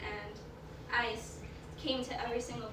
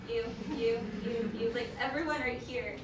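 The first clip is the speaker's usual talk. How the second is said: ordinary talk, nothing marked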